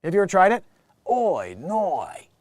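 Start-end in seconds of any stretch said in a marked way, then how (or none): 1.1-2.3 drawn-out mock Australian vowels; Australian accent. Shown as a demonstration. Comedian invites listeners to try his Australian accent. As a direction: play up a comic Australian accent. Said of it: "you can get into the Australian".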